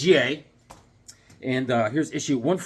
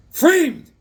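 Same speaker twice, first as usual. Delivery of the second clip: high, loud one-word outburst; dramatic exclamation; 11 semitones higher, 8 dB louder than usual. Pretend. Host exclaims over Superboy's plot, mock-dramatic shout. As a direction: punch it out in mock outrage.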